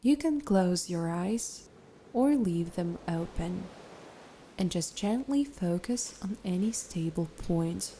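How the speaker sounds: unhurried, soothing instructions, easing off at phrase ends; guided meditation. Acted. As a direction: gently lead the listener into relaxation, letting each phrase settle.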